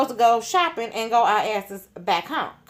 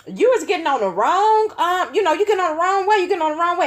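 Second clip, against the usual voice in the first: high, loud alarmed protest from the back seat; alarmed calling out; 11 semitones higher, 5 dB louder than usual. Retold or imitated. Telling the driver he took the wrong highway, high loud alarmed. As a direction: sound the alarm, pitch up and push.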